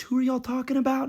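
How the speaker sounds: put-on high, curious old lady; grandma asking who they mean, pitched high. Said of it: "My grandma goes".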